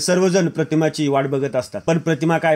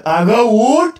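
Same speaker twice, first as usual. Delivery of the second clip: raised, louder call to get up; higher, louder joint prod; firm call to get up; 7 semitones higher, 6 dB louder, much slower than usual. Acted. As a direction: urge her up together. Brothers together urging her up.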